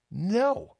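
a high squeaky No; high emphatic no. Shown as a demonstration. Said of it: "hell to the gnaw".

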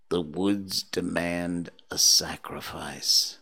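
a hint of a low, slow eerie voice; lightly voiced spooky kid, slow; creepy child voice. Host reading a creepy quote from a child, low and slow. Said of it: "he says".

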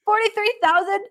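high, slow, stunned disbelief; you can't believe the number you just heard; incredulous. Real, not acted. Host stunned by forty-three thousand cap space, high disbelief.

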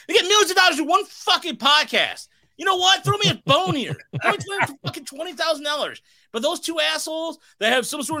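loud, high, furious rant about the money; angry rant. Real, not acted. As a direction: shout it out in outrage. Angry rant about celebrity podcast deal.

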